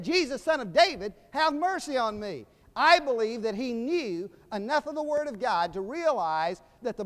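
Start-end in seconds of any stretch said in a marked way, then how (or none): 0.0-2.5 raised, louder pleading call; retold beggar's cry, pitched up. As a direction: call out for mercy from the roadside. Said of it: "He didn't cry out".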